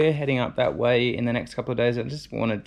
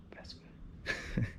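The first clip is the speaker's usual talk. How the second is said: whispering; 15 dB quieter, much slower than usual. Real, not acted. Whispered guess at a name.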